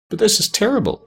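high-pitched dismay. A narrator's reading. Tin Woodman learns Oz is a fraud, dismayed. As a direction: exclaim it high, mildly distressed.

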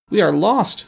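high-pitched doleful lament; storybook doleful cry. A narrator's reading. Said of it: "said the Scarecrow dolefully".

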